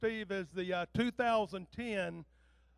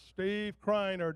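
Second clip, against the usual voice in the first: drawn-out announcer call; much slower than usual. Real, not acted. Emcee calls the guest again, drawn out.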